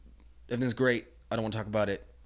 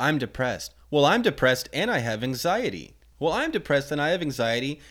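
each claim louder and higher, building; mock one-upping, ramping up with every claim; escalating one-upping; 8 semitones higher, 7 dB louder than usual. Mocking people who try to top each other's depression, building louder and higher. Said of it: "It's like".

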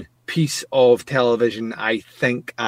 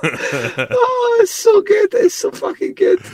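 high, helpless laughing voice; real high-pitched cracking up; laughing hard; slower than usual. Real, not acted. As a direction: squeeze the words out through laughter. Cracking up at the joke.

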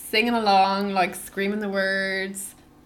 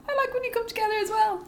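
playful high voice; 13 semitones higher, faster than usual. Retold or imitated. Joking reply to band.

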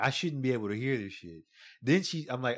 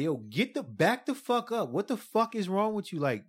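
re-enacting losing it, high-pitched; pissed off; 7 semitones higher than usual. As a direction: snap high in exasperated disbelief. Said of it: "I just got pissed and I fucking lost it".

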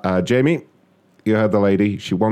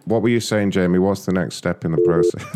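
ordinary talk, nothing marked

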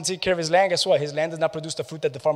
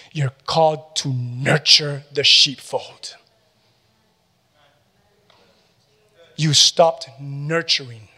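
slow stern rebuke; 4 dB louder, much slower than usual. Acted.

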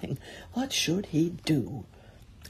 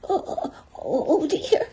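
high, quavering little whimper; frightened Piglet; 12 semitones higher, 3 dB louder than usual. A narrator's reading. Piglet fretting to himself by the trap, high and frightened. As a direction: squeak out a worried stammer. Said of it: "said Piglet to himself".

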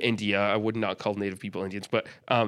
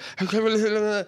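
high imitated giggle in a strange voice; mock host laugh; 8 semitones higher than usual. She imitates the fast-talking host's odd high laugh. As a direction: do his weird laugh. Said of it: "and he's like".

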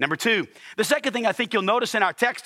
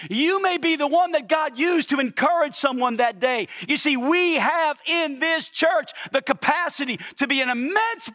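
raised preacher's voice, pressing the point home; fervent preaching; 8 semitones higher than usual. Acted. Pastor urging the congregation to encourage one another, fervent. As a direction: preach it with rising conviction.

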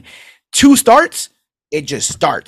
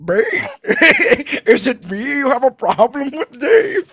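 mock partner voice; 10 semitones higher than usual.

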